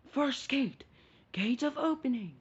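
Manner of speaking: high, loud chant of the technique; power-up shout. Retold or imitated. Naruto opening the first gate, power-up shout. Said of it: "and Naruto says".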